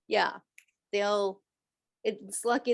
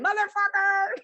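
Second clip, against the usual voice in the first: high childish mock swear; mock kid cursing; 11 semitones higher, 4 dB louder than usual. Psychic imagining kids swearing after watching her show. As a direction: blurt it like a kid who just learned it.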